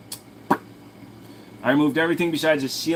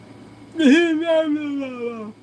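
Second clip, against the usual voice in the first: much higher, squealing laugh; 14 semitones higher, 3 dB louder than usual. Real, not acted. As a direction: break into a squealing giggle. Laughing at the frog.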